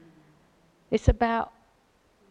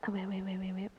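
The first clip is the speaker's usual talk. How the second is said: quiet self-interruption; 10 dB quieter than usual. Real, not acted. Reader stops himself mid-reading.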